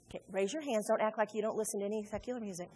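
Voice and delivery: ordinary delivery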